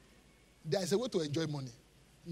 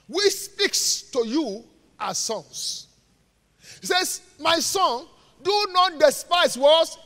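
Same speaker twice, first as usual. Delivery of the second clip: loud, slow, declaimed Bible verse; emphatic scripture reading; 6 semitones higher, 8 dB louder, slower than usual. Real, not acted. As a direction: proclaim the verse slowly, pausing for the congregation. Reads scripture aloud to congregation.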